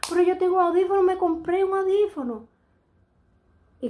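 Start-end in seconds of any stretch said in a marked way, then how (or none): 0.1-2.5 high, loud protest that "audífono" is hers; emphatic insistence. Real, not acted. Mother insisting to her daughter about the hearing aid, loud and high. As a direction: insist loudly, pitched up, voice rising.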